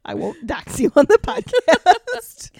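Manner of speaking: high, loud and drawn out; loud and slow. Real, not acted. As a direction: slowly promise it, teasing. Playful loud promise.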